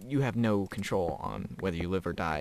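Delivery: ordinary talk, nothing marked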